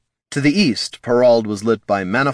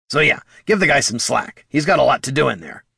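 raised, lively kobold voice; higher character voice, mildly defensive; kobold Bert, defensive; 7 semitones higher than usual. Acted. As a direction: argue for cutting someone slack.

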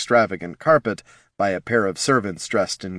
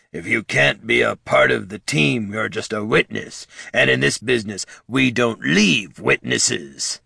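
sinister gravelly voice. Acted. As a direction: put on a gravelly villain's threat. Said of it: "Barty mimicked a sinister, gravelly voice".

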